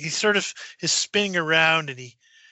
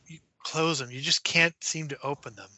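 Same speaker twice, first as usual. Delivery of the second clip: ordinary talk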